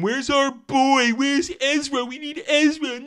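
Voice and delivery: high whiny pleading fan voice; begging fans. Retold or imitated. Reviewer mocks fans begging for Ezra, high whiny pleading. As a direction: whine like desperate fans begging. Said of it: "where they've been begging for".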